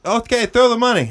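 mock call up to a balcony; acted distant holler; imitated calling up.

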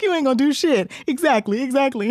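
high laughing dare; mock taunt. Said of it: "she's like".

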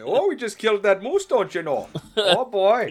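high, loud hammed-up Canadian accent. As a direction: go full Fargo Canadian. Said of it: "start talking in, like, Canadian accents".

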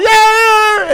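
high loud cheer of Yeah; excited scream. Retold or imitated. Wife cheering son at football game, loud high scream. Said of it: "screaming and yelling".

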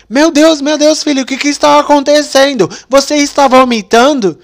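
high, loud panicked mother; worried mom. Retold or imitated. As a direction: cry out like a frightened mother. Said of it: "ela falou". (mãe assustada, aguda e alta)

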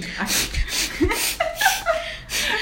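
very high, shrieking laugh. Real, not acted. Reader cracking up at the fanfic, squealing laugh.

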